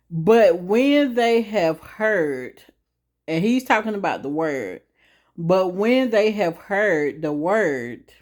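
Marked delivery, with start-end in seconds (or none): 0.1-2.6 drawn-out scripture phrase; slow reading. Shown as a demonstration. Reading the key verse slowly to viewers. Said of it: "I'm gonna read it a little slow". 5.4-8.1 measured scripture phrase; slow reading. Shown as a demonstration. Reading the key verse slowly to viewers. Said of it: "I'm gonna read it a little slow".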